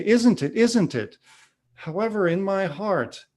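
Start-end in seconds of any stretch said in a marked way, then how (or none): 0.0-1.2 retold guru pressing, higher and louder; insistent guru. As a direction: push the question at him, higher and louder, imitating.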